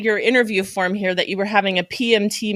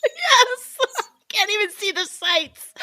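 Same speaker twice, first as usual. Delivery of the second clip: high, laughter-filled words; laughing; 12 semitones higher, slower than usual. Real, not acted. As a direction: say it through real laughter. Host laughing at the story.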